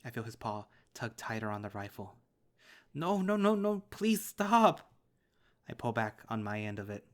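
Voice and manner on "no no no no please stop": high, loud panicked scream, stretched slow at the end; terrified child begging dad not to shoot; desperate screaming. Acted. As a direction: scream at him to stop, terrified. Dad raising rifle to chin.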